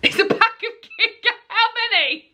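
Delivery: high, laughing incredulity; amused disbelief. Real, not acted. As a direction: question it in real astonished amusement, pitch way up. Reacting to what David bought.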